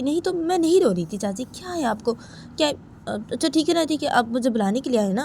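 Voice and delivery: high, swooping daughter-in-law voice; Uma protesting. Acted. Daughter-in-law denies crying and agrees to go, high-pitched protest. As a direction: deny it in a high, insistent lilt.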